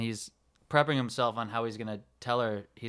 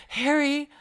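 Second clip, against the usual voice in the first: Lloyd impression; 13 semitones higher, 9 dB louder than usual. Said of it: "And Lloyd goes".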